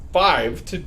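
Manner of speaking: ordinary delivery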